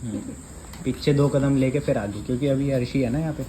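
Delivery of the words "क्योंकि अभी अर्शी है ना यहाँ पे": ordinary talk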